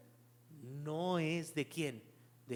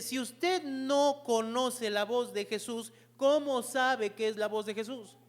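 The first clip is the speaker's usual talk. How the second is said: raised, higher-pitched sermon voice; forceful preaching; 6 semitones higher, 7 dB louder than usual. Real, not acted. Preacher urging congregation to know God's voice, forceful preaching. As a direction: press the congregation with urgent, emphatic points.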